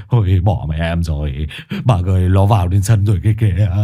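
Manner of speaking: low, whimpering plea close to crying; low tearful pleading man; near tears. Acted. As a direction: beg as if about to cry. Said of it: "nói như sắp khóc".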